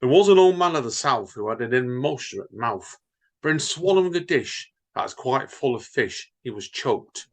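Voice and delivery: bouncy sing-song limerick reading; jaunty comic verse reading. Acted. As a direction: read the nonsense verse with playful rhythm. Reads Edward Lear limericks.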